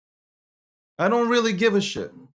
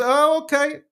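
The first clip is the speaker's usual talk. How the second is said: a higher, light mock "oh, okay"; meek going-along; 6 semitones higher than usual. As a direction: play the host who swallows his words. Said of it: "and I just".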